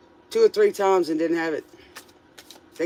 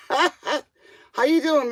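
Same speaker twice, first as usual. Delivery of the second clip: ordinary delivery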